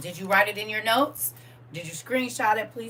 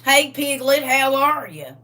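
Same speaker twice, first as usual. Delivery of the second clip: loud, higher country Southern greeting; brother's Southern accent; 5 semitones higher, 7 dB louder than usual. Retold or imitated. Brother greeting sibling by nickname, hammed-up Southern accent. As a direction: imitate a Southern brother hollering hello. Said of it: "my brother sounds like that".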